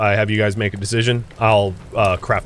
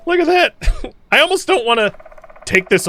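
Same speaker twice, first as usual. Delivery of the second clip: much higher, laughing through it; laughing delight; 3 dB louder than usual. Real, not acted. Showing viewers the huge sword, laughing. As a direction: giggle at how ridiculous the blade looks. Delight at freshly crafted blade.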